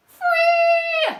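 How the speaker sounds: high, loud jubilant shout; joyful cheer. Acted. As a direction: celebrate the escape. Triumphant escape.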